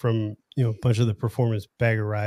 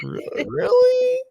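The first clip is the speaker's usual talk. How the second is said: very high, drawn-out incredulous question; mock disbelief; much slower than usual. Rider asking cops about one percenters, mock disbelief. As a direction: stretch it out high, as if you can't believe it. Retold disbelief at cops' suspicion.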